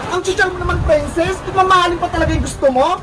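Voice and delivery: normal talking voice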